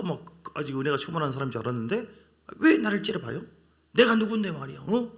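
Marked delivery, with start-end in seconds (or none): 2.6-5.1 higher, louder indignant retort; imitating an arrogant huff; mock haughty offense. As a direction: act the proud believer bristling at a glare. Mimics proud believer taking offense.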